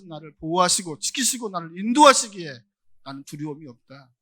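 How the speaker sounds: loud, fervent sermon climax; full-force preaching. Acted. Pastor blessing the congregation with conviction, loud preaching. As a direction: proclaim it to the whole congregation.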